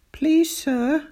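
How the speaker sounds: high-pitched polite plea; pleading schoolboy. A narrator's reading. Sydney interrupting angry teacher, pleading. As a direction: pipe up as a schoolboy. Pupil timidly owns up to teacher.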